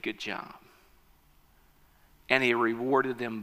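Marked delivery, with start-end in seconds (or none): 0.0-0.6 soft king's praise. Acted. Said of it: "And the king said".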